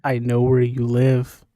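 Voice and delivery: menacing prank-caller threat; heavy breathing phone whisper. Acted. Creepy joke threat to Rob.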